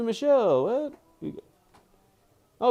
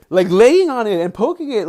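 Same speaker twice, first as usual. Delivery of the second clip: loud, higher rush of disbelief; much louder exasperated complaint; exasperated outburst; 7 semitones higher, 12 dB louder than usual. Real, not acted. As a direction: vent about the pesky dog. Exasperated at dog on camera.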